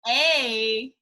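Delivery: usual speaking voice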